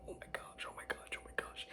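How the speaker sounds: whispered mock panic, repeated under the breath; freaking out quietly. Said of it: "I'm, like, freaking out".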